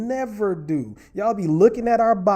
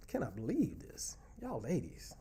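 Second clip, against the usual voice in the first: unvoiced disbelieving whisper; hushed scandalized whisper; 8 semitones lower, 15 dB quieter than usual. Real, not acted. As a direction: breathe it out in mock dismay. Mock dismay at the comments.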